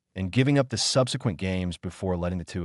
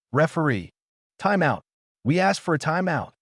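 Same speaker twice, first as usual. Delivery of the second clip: higher-pitched call, little added volume; understated shout, pitched up; mild narrated shout; 7 semitones higher than usual. A narrator's reading. Player calls to the referee for a timeout, mild shout. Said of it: "and shouted".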